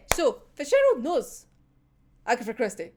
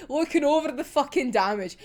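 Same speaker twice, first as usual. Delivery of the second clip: normal talking voice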